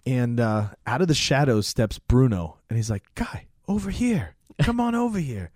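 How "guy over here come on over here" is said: high, eerie come-hither call; calling Guy over. Retold or imitated. Bruno calls Guy from the shadows, a high luring call. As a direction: lure him in from the dark.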